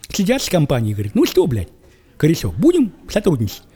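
ordinary delivery

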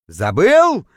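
loud, incredulous question; first stage of an outraged bellow; indignant roar. A narrator's reading. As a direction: burst out in disbelief. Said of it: "взревел, точнее, даже завыл я".

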